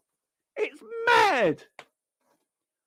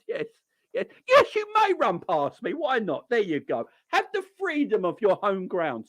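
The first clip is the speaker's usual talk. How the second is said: mocking Matip; 9 semitones lower than usual. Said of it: "Matip's like".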